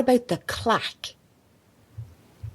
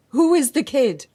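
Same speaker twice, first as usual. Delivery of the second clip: louder, higher confronting question; sharp demand; 6 semitones higher, 5 dB louder than usual. Acted. Reporter confronting Carl about the kid, loud and sharp. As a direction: hit him with the question.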